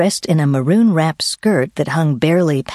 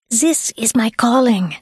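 put-on mild Swiss-accented yell; 8 semitones higher than usual. A narrator's reading. Swiss woman insisting to a hesitant hiker, mild yell, Swiss accent. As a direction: exclaim lightly in a high Swiss accent. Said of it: "she yelled".

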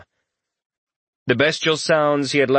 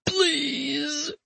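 high, soft, drawn-out cry for help; weak plea; 6 dB quieter than usual. A narrator's reading. Injured man begging his companion, weakly calling out. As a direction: plead feebly in a thin high voice. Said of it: "The castrated man called out weakly".

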